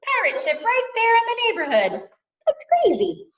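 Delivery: high, loud, quick amazement; amazed excitement. A narrator's reading. Storyteller amazed by a pirate ship in the neighborhood, excited.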